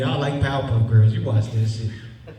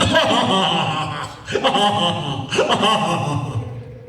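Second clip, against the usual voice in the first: mocking laugh. Real, not acted. Laughs at baby mama.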